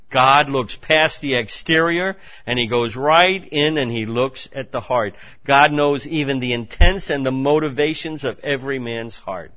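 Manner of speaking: full-voiced pulpit delivery; forceful preaching. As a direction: proclaim it to the congregation.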